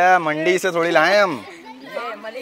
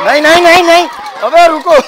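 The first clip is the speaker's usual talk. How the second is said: loud, high frantic no; shouting no as the goat bolts; panicked shout; 11 semitones higher, 9 dB louder than usual. Real, not acted. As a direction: yell in alarm. Goat runs off, panicked calling.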